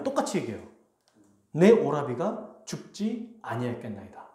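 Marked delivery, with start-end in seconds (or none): none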